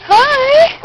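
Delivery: high sing-song puppy greeting. Real, not acted. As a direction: coo at the puppy. Greeting puppies.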